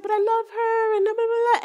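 high, whiny imitated blubbering; mock crying. Man pleading at red light, mock-tearful whine. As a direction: wail it high, trailing into babble. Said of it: "And he starts crying".

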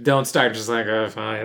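low, quiet resigned mumble; deflated, under-the-breath surrender; given up. Retold or imitated. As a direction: mimic someone who has given up, low and soft. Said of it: "she's given up".

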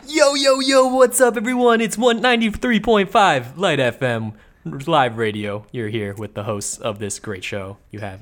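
radio DJ hype. Acted. Host opening the podcast as a hyped radio DJ, loud. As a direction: boom it out like a morning radio DJ. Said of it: "You sound like one of those commercial".

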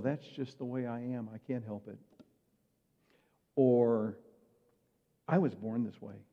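normal talking voice

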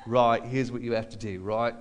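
lightly imitating the Australian road manager; terrible Australian impression. Road manager telling the band how to dodge visas, lightly put-on Australian accent. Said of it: "I'm gonna do a terrible impression of him".

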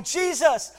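high, loud cry for help; desperate shout. Acted. Blind Bartimaeus calling out to Jesus, shouting. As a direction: call out over the crowd. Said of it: "He's, he's shouting".

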